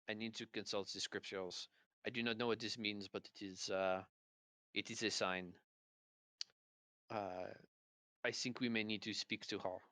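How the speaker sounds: put-on subdued, deliberate French-accented English; halting French accent, weighing each phrase; slight French accent. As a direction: ponder aloud in a light French accent. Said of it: "a slight French accent".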